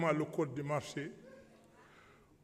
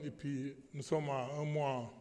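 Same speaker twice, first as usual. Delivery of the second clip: ordinary talk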